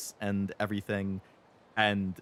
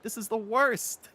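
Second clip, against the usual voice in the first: mock despair; 11 semitones higher, 3 dB louder, slower than usual. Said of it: "are like".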